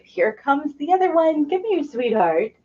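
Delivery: high, soft coo to the pup. Real, not acted. Welcoming the second puppy in soft, high baby talk.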